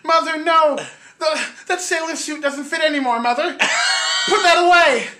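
high, hammed-up shouted protest to Mother; mock-horrified shrieking, very loud; Psycho-style screaming. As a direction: yell like a melodramatic movie son pleading with Mother. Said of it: "in Psycho you do hear the mother scream".

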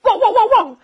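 high, quick imitated woofs; dog barking. Dog butts into the quarrel, barking high. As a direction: bark like an excited dog. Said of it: "狗在一旁说了".

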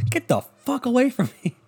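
high, put-on voice telling someone off; mock-appalled brush-off in a high voice; repelled kid. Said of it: "And he goes".

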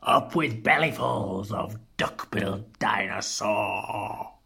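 low, soft, menacing chant; storybook menace, held down; mock shout. A narrator's reading. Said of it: "And they shouted".

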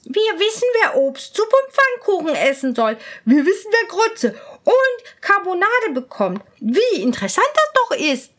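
gleeful court ladies. Acted. Ladies-in-waiting dance and clap, high and gleeful. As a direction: crow it out, delighted. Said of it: "Die Hofdamen tanzten und klatschten".

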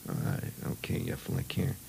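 hushed, low conspiratorial voice; secretive hush. Retold or imitated. Boy hushing his friends before the reveal, low and hushed. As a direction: keep it secret from the others, softly.

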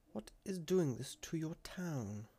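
slow question, pitched high; slow, careful. A narrator's reading. Clan leader coaxing a witness, slow and careful. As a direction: draw it out so as not to alarm him. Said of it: "Slowly, so as not to alarm the lad".

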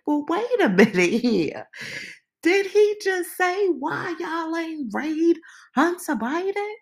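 high, loud stunned disbelief; shocked disbelief. Retold or imitated. Recalling hearing the FBI question, stunned high disbelief. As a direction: pitch jumps up in astonishment. Retelling own shocked reaction.

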